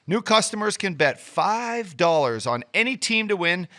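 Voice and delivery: upbeat hyped sponsor read. Acted. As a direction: sell it like a sportscaster. Ad read by host.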